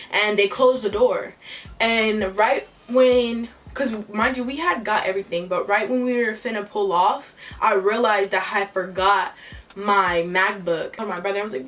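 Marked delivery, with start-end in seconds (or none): none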